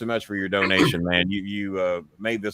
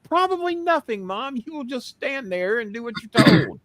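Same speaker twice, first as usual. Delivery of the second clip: bossy; 11 semitones higher than usual. Retold or imitated. Bossing mom.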